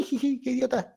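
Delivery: soft snickering jeer, mostly breath; mocking giggle. Coworkers mock him after a blunder, soft mocking giggle. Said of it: "se van a reír así".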